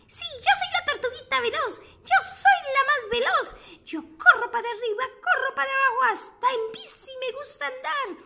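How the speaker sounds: put-on high, bouncy child tortoise voice; chirpy little turtle bragging how fast she is. Storyteller to children, as the fast little turtle, high and bouncy.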